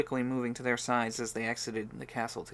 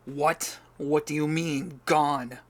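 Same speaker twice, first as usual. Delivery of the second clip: loud, insistent questions, slower at first; demanding; 3 semitones higher, 7 dB louder, slower than usual. Acted. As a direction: demand an answer from Jacob. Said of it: "he demanded".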